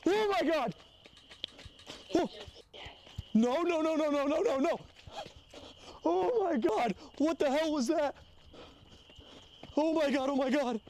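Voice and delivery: high panicked shrieks of fright; terrified screaming. Real, not acted. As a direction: scream in real terror, pitch way up. Frightened screams during ghost hunt.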